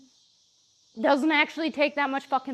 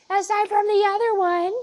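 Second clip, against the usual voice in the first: playful high aside; 10 semitones higher, 7 dB louder than usual. Real, not acted. Teasing aside to dog.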